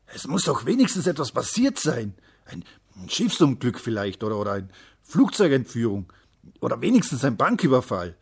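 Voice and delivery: loud, agitated father; worked-up demand with heavy punches on the key words; indignant outburst. A narrator's reading. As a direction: insist loudly, groping for examples. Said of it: "rief sein Vater aufgebracht".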